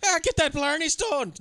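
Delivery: high, slow Irish caricature; mock Irish outburst. Mimicking the actor's outbursts, hammed-up Irish accent. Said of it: "he has outbursts where he'll be like".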